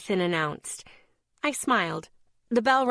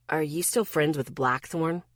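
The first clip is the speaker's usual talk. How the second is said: low, strained question; tense voice; 4 semitones lower than usual. Acted. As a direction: hold the tension, lower. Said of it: "in a tense voice".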